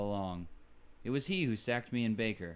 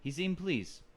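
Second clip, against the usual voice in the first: usual speaking voice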